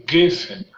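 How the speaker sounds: "Geffen" stressed on GEF, then falling; stress on first syllable. Shown as a demonstration. Teacher contrasting two Hebrew words, first-syllable stress on "Geffen". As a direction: punch the start of "Geffen". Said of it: "sur la première syllabe".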